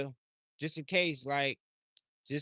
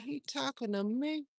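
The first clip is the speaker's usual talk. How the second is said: high, mock-puzzled snake; 10 semitones higher than usual. Snake in the woods asks who's calling it, high puzzled. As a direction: squeak it like a startled snake. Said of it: "The snake gonna be like".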